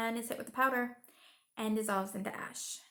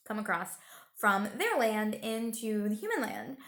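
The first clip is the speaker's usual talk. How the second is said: ordinary talk, nothing marked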